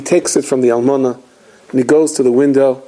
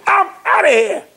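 fed-up guest leaving. Retold or imitated. Guest fleeing the Seder.

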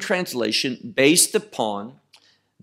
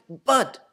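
"But" raised high and held apart; emphatic but; 6 semitones higher than usual. Shown as a demonstration. Preacher repeating Jesus' words, stressing "But" before the resurrection. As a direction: lean hard on "But" before the next phrase. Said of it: "makes that next part emphatic".

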